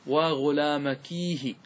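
slow model of "waa ghulaamakiihi" for وغلامكيه; ha of pause. Shown as a demonstration. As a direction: say وغلامكيه slowly, long "kii" plus "hi". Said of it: "ثم أضفنا بعدها هاء السكت".

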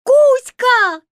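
high excited shout. Acted. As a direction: call out happily. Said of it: "закричали русалки".

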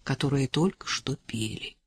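low, quiet, mildly pained correction; subdued, a touch displeased; slight wince. A narrator's reading. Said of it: "Чуть поморщился Лемешев".

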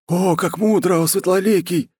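high-pitched servile praise; fawning flattery. Acted. New war chief praises the khan, high fawning voice. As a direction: gush in admiration, very high.

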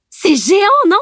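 high, loud delighted shout; excited cry. A narrator's reading. Camille calls to friends on the windy deck, excited. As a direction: shout it over the spray, thrilled. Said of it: "cria Camille".

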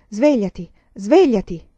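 calling to wake. A narrator's reading. Chiama il fratello a svegliarsi. (richiamo per svegliare, in crescendo)

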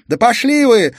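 very loud, high, hurled out; furious shout. Acted. Sofia screams at the detectives to get lost, furious. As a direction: scream it at them in fury. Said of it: "яростно выкрикнула София".

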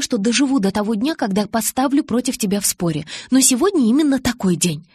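high, animated arguing; confident Alice. A narrator's reading. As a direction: insist brightly that you know best. Narrator voices Alice arguing.

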